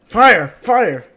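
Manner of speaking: loud alarmed yell; yelling fire in panic; shouting fire. Real, not acted. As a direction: raise the alarm at the top of your voice. Calling out a house fire.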